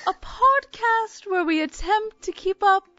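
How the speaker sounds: put-on big Danish accent, mouth wide open; Meryl Streep Danish accent. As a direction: Danish accent, high and slow. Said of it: "I'm imitating Meryl Streep attempting a Danish accent".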